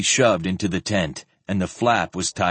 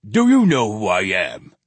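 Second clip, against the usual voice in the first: a touch louder, pushy challenge; pugnacious demand; 4 dB louder than usual. A narrator's reading. Official challenging Sparhawk, a pugnacious demand. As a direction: square up to the stranger. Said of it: "he demanded finally in a pugnacious tone".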